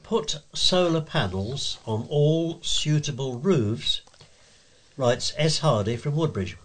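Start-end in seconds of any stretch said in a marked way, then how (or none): 0.0-4.0 slow, deliberate headline delivery; measured announcer reading a letter's title. Shown as a demonstration. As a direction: set the title apart, spelling it out slowly.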